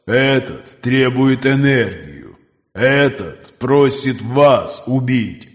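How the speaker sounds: slow, distant predatory creature; monster voice. Acted. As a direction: speak like a cold ancient beast. Creature Nokris speaks.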